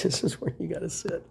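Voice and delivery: whispered annoyed aside; unvoiced grumble. Real, not acted. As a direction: hiss it under your breath. Mutters about someone sitting close.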